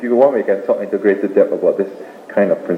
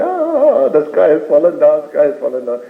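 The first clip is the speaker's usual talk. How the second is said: mock shocked cry; 4 semitones higher than usual. Startled rabbit warning the whole forest, hammed-up shocked cry. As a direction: play a panicked animal raising the alarm. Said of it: "he got up with a shock".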